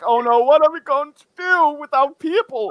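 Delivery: high-pitched, worried wail in imitation; mock panic. Host frets over missing guests, in mock panic. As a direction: act out someone fretting, pitched well up.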